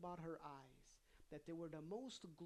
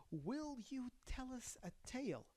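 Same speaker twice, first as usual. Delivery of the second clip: lightly voiced Sultana; 8 semitones higher than usual. A narrator's reading. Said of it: "said the Sultana".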